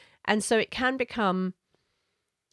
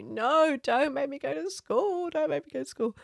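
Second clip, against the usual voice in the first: put-on much higher, pleading child's voice; 8 semitones higher than usual. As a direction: beg like a child who won't go to school. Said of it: "start just crying and going, pleading with you".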